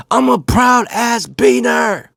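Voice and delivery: high, drawn-out proud shout; acting out dad's proud holler, stretched out; yelling. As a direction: yell it out the car window. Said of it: "he started yelling it".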